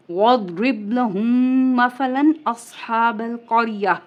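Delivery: measured chanted recitation with a stop on "الْقَرْيَةِ"; Quran recitation. Acted. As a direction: recite like tilawat, stopping at the mim sign.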